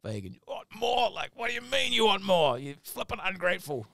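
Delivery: high, indignant disbelief; hammed-up indignant boss; mock outrage. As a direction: play the offended boss, half-joking. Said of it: "you feel a bit like".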